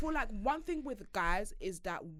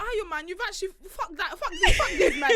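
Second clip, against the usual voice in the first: high, loud indignant outburst; fired up and laughing in disbelief; outraged; 10 semitones higher, 8 dB louder than usual. Real, not acted. Outraged on friend's behalf.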